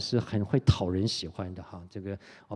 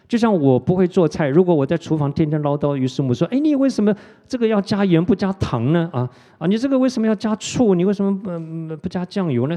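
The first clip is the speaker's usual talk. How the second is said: higher, louder whiny nagging at the wife; mock nagging; 7 semitones higher, 7 dB louder than usual. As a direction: pester her in a high, loud voice. Imitating himself nagging his wife.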